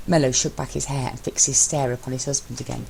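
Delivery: ordinary delivery, nothing marked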